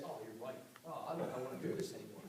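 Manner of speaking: mock timid doubter, low and soft; hushed mock voice of a wavering recruit. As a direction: imitate someone losing their nerve, quietly and fast. Mocking a doubter losing nerve.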